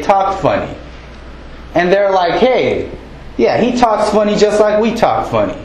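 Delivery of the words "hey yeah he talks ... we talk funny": lightly acted local, higher pitched; hometown locals. Townsfolk recognising one of their own, lightly acted higher voice. Locals talking about shared accent.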